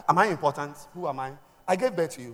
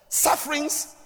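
higher, slow word through a laugh; 13 semitones higher, slower than usual. Real, not acted. Preacher recalling a girl's suffering, laughing. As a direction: laugh as you repeat the word.

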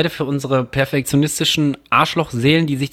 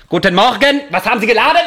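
high, loud, laughing stiff German; caricatured German accent; 9 semitones higher, 4 dB louder, faster than usual. Retold or imitated. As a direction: bark it like in an old war movie. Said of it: "so wie die Engländer sich oder die Amis sich vorstellen, wie man Deutsch spricht". (übertriebener deutscher Akzent, lachend)

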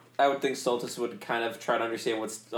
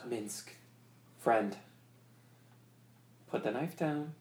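softer gentle coaxing; soothing; 3 semitones lower, 7 dB quieter than usual. Acted. Stultus talks Minsk into lowering the knife, soft coaxing. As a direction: speak softly to settle him.

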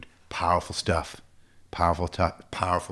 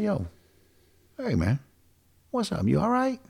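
higher-pitched, easy "you good?" voice; casual check-in; 11 semitones higher than usual. Retold or imitated. Coach checking on a heavy-hearted client, casual and higher. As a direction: check on a buddy, lifting into the question. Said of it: "I talk like this".

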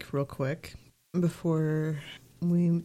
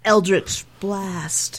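dramatic spell incantation; 3 semitones higher, 4 dB louder, much slower than usual. Pretend. Mock spellcasting.

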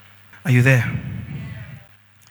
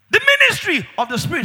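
exclaimed; 9 semitones higher, 4 dB louder, faster than usual. Acted. Preacher exclaiming the point.

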